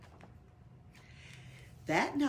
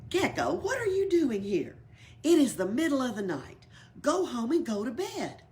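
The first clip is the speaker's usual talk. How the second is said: stern scolding; 6 semitones higher, 7 dB louder than usual. Acted. Elephant scolds gecko at night.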